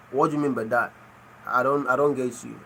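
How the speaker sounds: usual speaking voice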